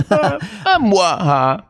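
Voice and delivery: hammy vampire chuckle; Dracula laugh. Retold or imitated. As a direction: laugh like a mustache-twirling Dracula. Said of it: "He goes".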